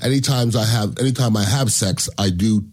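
host ad read dropped into the show; sponsor ad read. Acted. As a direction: read the sponsor spot smoothly.

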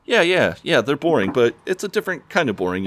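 put-on high-pitched, insistent teen; imitated defensive concession. Neil agreeing they're boring but defending them, high voice. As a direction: concede hastily in a lifted, defensive voice.

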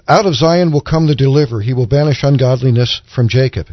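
ordinary delivery

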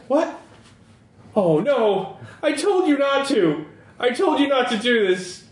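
high-pitched dismayed protest; panicked character. Acted. Same upset character in a panic.

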